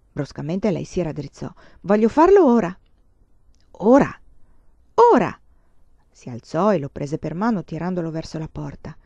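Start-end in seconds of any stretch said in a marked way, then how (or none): 1.8-2.8 loud, eager demand; sudden insistence. A narrator's reading. Girl sitting up, loudly demanding to go now. 3.8-5.4 loud, high astonished echo; startled. A narrator's reading. Suitor startled by her demand, echoing loudly.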